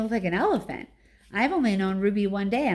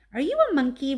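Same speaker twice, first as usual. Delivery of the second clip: put-on lightly raised child voice; curious little elephant; 6 semitones higher, 5 dB louder, faster than usual. A narrator's reading. Baby elephant asks Ivan, curious light child voice. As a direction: ask it like a curious small child.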